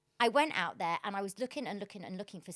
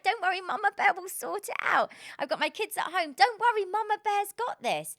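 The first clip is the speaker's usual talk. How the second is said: higher, louder motherly Mama Bear act; 10 semitones higher, 6 dB louder than usual. Retold or imitated. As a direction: play the protective mama reassuring her kids. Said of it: "I feel like I'm Mama Bear".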